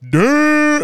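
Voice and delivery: loud, high, drawn-out "damn"; mock-astonished exclamation. Man clowning his friend over who he slept with, mock-astonished. Men clowning each other about sex.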